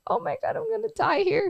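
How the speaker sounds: high panicked voice; hammed-up fear of dying; mock panic. Panicking while held.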